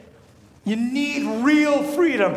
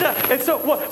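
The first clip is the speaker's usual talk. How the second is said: ordinary delivery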